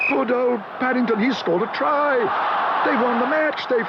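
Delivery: put-on high, thrilled boy; boy cheering the bear's try; excited cheering. A narrator's reading. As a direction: cheer the win. Jonathan cheering.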